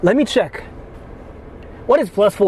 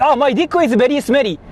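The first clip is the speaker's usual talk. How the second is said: higher, halting mock Japanese accent with broken English; 5 semitones higher, slower than usual. Streamer pranking scam callers in a mock Japanese accent. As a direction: play a Japanese caller who barely speaks English. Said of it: "And I'm Japanese too, so I can just say something like".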